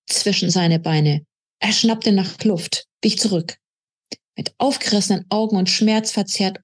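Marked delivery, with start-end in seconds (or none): none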